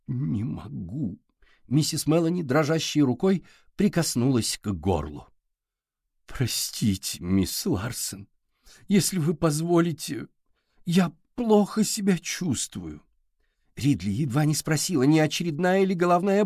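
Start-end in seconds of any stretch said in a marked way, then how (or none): 0.1-1.2 slow, soft, wavering voice; anguished refusal. A narrator's reading. Woman with trembling hand refuses to answer, in a slow wavering voice. 6.3-13.0 slow, halting, wavering excuse; faint and unwell. A narrator's reading. Woman begging off as she feels ill, in a slow, faltering voice.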